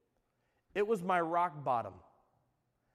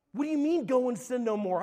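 louder, higher, incredulous challenge; exasperated; 5 semitones higher, 5 dB louder than usual. Acted. Young believer answering those who handed him a verse, exasperated. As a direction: throw the advice back in frustration. Acts out his frustrated past reply.

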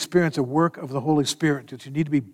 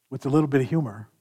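ordinary delivery

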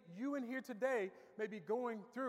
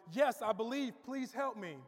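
urgent plea; 3 semitones higher, 4 dB louder than usual. Retold or imitated. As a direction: plead like a man dangling from a cliff. Cliff man pleads with the voice.

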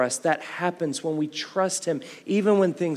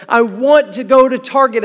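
put-on high, louder whiny little-girl voice; whiny child; 8 semitones higher, 11 dB louder than usual. Four-year-old daughter demanding Target from dad, whiny and high. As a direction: whine it like a four-year-old who won't give up.

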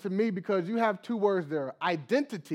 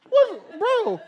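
surprised; 14 semitones higher, 8 dB louder than usual. Retold or imitated. Reacting to son's tantrum.

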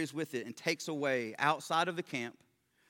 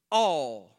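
"all" made louder with a pause after it; a louder, drawn-out "all"; stress on all; 7 dB louder than usual. Shown as a demonstration. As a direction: let "all" ring before "their sins". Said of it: "I tried to stress the word all here".

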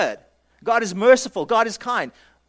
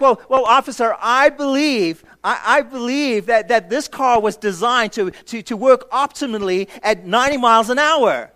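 louder, higher, halting plea to the officer; mock pleading driver; 3 semitones higher, 6 dB louder than usual. A speeding driver pleads with the officer, hammed-up and stammering. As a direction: stammer out an excuse like a caught speeder. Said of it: "you would go like".

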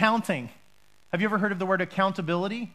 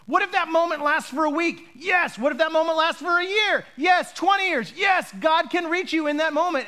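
raised, high-pitched insistent preaching; preacher building fervour at a higher pitch; impassioned preaching; 6 semitones higher than usual. Real, not acted. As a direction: fire off questions and answer them emphatically. Preacher fires questions and emphatic answers.

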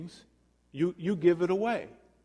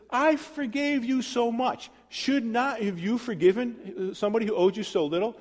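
master rebuking; 7 semitones higher than usual. Retold or imitated. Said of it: "he asks them a rhetorical question".

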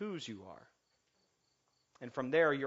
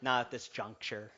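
a hint of George Bush, lower and softer; clipped presidential mimic, lower and quieter; George Bush impression; 4 semitones lower, 7 dB quieter than usual. Preacher doing Carvey's Bush to the congregation, lightly voiced. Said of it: "George Bush impressions".

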